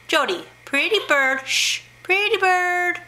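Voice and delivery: high singsong bird talk; coaxing a parrot. Real, not acted. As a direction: call and coo to a pet bird.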